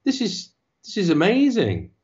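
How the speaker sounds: a high, breathless whoa of wonder; amazed. Retold or imitated. Cast reliving the moment the show came back, amazed. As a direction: sound bowled over by it. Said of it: "And we were like".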